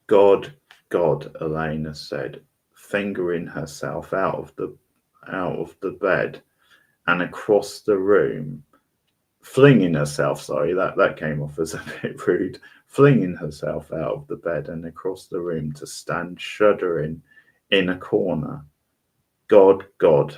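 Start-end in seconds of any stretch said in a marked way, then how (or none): 0.1-1.3 loud, gasping cry of God; terrified. A narrator's reading. Elena cries out in horror, backing into a corner, loudly terrified. 19.5-20.3 loud, shaken cry of God; horrified. A narrator's reading. Elena realizes whose hand she held, crying out loudly in horror.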